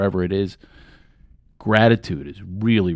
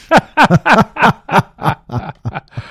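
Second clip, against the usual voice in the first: long rolling laugh, higher and louder, fading down; long demo laugh; 10 semitones higher, 6 dB louder than usual. Shown as a demonstration. Coach leading one more group laugh, long and rolling. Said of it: "Let's do one more".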